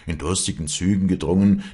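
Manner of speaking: usual speaking voice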